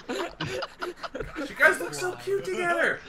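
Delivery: high, slow, laughing squeal; delighted, words riding on laughs; laughing gush. Real, not acted. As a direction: coo it high through laughter. Gushing during a laughing fit.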